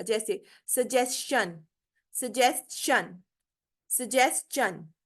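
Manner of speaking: slow सजेशन with स and च blended; drawn out सजेशन, s-ch in the middle; slow model word. Shown as a demonstration. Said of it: "ये शन की आवाज नहीं निकालनी". (धीमे से सजेशन, स और च मिलाकर)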